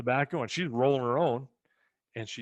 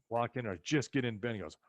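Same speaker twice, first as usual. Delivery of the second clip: ordinary talk, nothing marked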